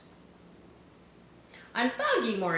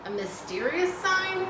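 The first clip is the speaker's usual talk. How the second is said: higher, marvelling at a wonder; awed; 12 semitones higher than usual. A narrator's reading. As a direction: let the wonder lift the voice. Husband awed.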